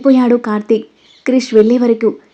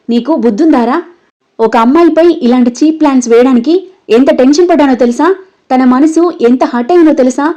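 ordinary delivery

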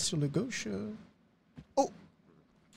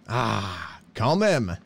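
usual speaking voice

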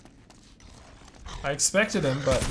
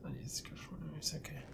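soft relieved whisper; whispered relief; 3 semitones higher, 15 dB quieter, faster than usual. Real, not acted. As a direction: whisper to calm yourself. Relief after scare.

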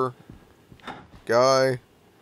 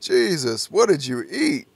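ordinary talk

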